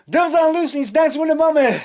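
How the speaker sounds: high, sing-song spooky character voice; Doobie's voice. Retold or imitated. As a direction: chant it like a giddy ghoul.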